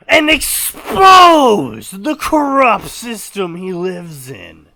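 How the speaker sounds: loud, high, drawn-out proclamation; grandiose story reading. Acted. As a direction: blast it out slowly, then settle. Reads a story aloud.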